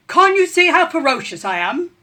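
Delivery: loud, high ferocious rat voice; rat boasting. A narrator's reading. Rat bragging loudly to the pecking chickens. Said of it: "said the rat".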